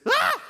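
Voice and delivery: very high, loud terrified shriek; sudden alarmed scream; shriek of terror. Shown as a demonstration. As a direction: shriek as if shocked and cornered. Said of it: "it's a shriek of terror".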